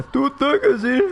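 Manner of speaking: high, slow, loud mocking call; hammed-up call across the field. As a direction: shout back like calling someone home.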